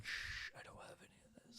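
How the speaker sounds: whispered shushing, very soft; shush, then whisper it secretively; playful hushed whisper. Pretend. Playing a shady seller to his buyer, playful whisper.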